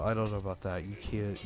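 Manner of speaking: ordinary talk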